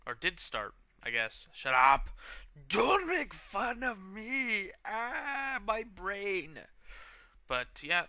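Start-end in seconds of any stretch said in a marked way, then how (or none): 1.5-2.1 loud playful outburst; mock shout. Shouts at camera mock outrage. 2.7-4.7 high, slow fake sob shout; mock crying. 4.8-6.6 high strained pained cry; hammed-up brain freeze agony.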